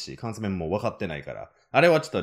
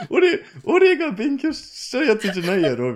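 laughing disbelief; 10 semitones higher, 6 dB louder than usual. Retold or imitated. Retold confusion, laughing.

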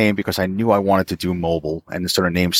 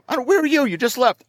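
very high, flustered where are you; retold confusion on the phone with Keith; confused disbelief. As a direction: sputter in bewilderment. Keith calls asking where he is.

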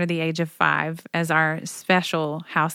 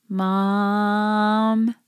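put-on a child's small voice calling out Mom in the night; sleepy child calling; 3 semitones higher than usual. Kid calling his mom at night on the bus, small child's voice. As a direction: voice the little one calling from the bunk.

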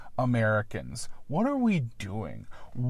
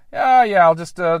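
breezy higher, louder offhand "yeah, yeah"; casual composer; 4 semitones higher, 9 dB louder than usual. Retold or imitated. Retelling how James Horner shrugged off his sketch, breezy and loud. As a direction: toss it off like a composer noodling around. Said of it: "He was just kinda like".

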